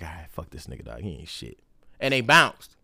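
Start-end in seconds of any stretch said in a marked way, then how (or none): 0.0-1.6 low, much softer bored voice; voicing the birds, muttered and low; dismissive bird voice. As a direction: shrug him off as not worth it. Birds' voice giving up.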